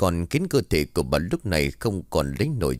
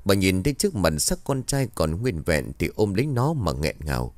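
usual speaking voice

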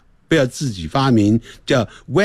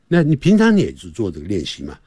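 normal talking voice

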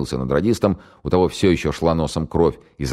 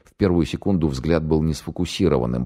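ordinary delivery, nothing marked

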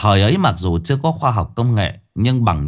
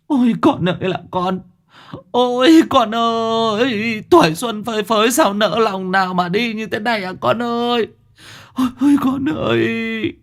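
keen and wail over the body; wailing lament; 13 semitones higher than usual. Acted. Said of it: "mà khóc lên khóc xuống".

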